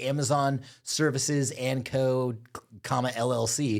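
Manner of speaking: drawn-out fake company name, very slow; slow mock company name. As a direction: spell out the fake company name slowly, mocking. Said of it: "They're like".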